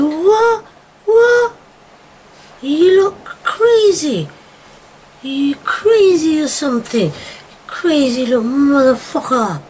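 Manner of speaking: high, loud, slow furious disbelief; hammering outraged shout; incredulous rage. Retold or imitated. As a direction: rage at him, shocked. Inner rant at the provoker.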